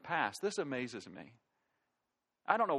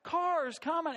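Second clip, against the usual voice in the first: exasperated driver; 7 semitones higher than usual. Retold or imitated. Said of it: "I'm like".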